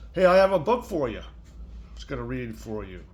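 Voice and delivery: ordinary delivery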